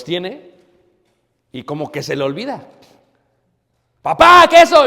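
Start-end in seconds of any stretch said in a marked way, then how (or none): 4.0-4.9 put-on high, loud child's yell; retold shouting. Son yelling to his father on a plane with earbuds in, shouting. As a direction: shout it like someone who can't hear himself. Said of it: "Y me empieza a gritar".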